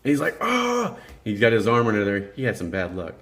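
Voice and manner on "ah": high mock yelp of pain; mimicked pain cry. Car slips onto coworker's arm, mimicked pain cry. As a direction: imitate a drawn-out cry of pain. Imitates Chuck's pained cry.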